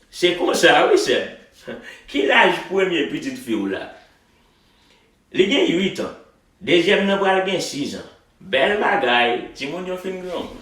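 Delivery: lively Haitian Creole conversation read at native speed; real Haitian accent. Shown as a demonstration. Teacher performs the dialogue for students in a real Haitian accent. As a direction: read it the way you'd talk to a Haitian. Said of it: "I'm gonna read it with some real Haitian accent for you".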